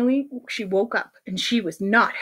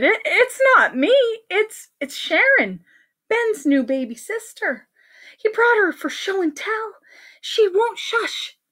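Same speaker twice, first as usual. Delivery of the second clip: high, stammering excuse; flustered teacher; 9 semitones higher than usual. Acted. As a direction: plead your innocence, flustered.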